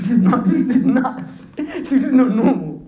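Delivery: loud, high, slow astonishment; shocked disbelief. Retold or imitated. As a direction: act out a man stunned by his luck. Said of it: "'Cause the man's shocked".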